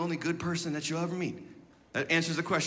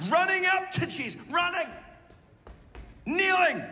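fervent preaching cry; 9 semitones higher than usual. Acted. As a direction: hammer each verb out to the crowd. Preacher building to a climax.

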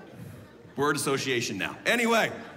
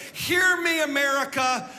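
loud and higher, pleading; preaching call-out; 8 semitones higher, 4 dB louder than usual. Acted. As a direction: call the whole nation to listen. Preacher calls out to nation.

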